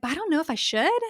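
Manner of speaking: high, quick, mock-unsure quote; lightly mimicking someone unsure; imitating hesitancy. Imitating unsure women seeking validation.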